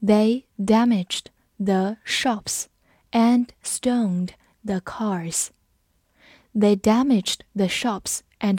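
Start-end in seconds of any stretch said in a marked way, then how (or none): 0.0-5.5 word by word, spaced out slowly; slow reading. Shown as a demonstration. Teacher models the sentence for learners, slow reading. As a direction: read it very slowly with gaps between words. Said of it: "我们慢读一遍".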